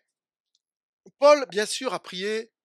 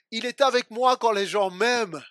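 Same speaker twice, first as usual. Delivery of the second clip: raised, loud declaration of faith; preached affirmation; 4 semitones higher, 8 dB louder than usual. Acted. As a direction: proclaim it high and strong. Preached contrast, good times. (affirmation prêchée avec force)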